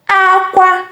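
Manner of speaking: "Akwa" high on both syllables; high-high tones on "Akwa"; cry tone. Shown as a demonstration. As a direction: hold "Akwa" high and level to the end. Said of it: "Igbo language is a tonal language".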